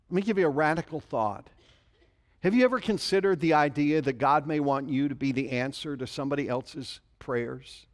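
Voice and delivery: usual speaking voice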